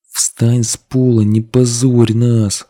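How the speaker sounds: ordinary delivery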